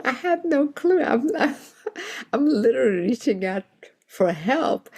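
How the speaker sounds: half-laughing in disbelief; giggling while telling the agent how lost she is. Real, not acted. Laughs at her own situation.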